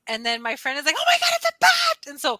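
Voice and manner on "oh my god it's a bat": very high, loud, fast alarm; panicked scream. Retold or imitated. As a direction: shriek in a rush on spotting the bat. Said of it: "we started screaming".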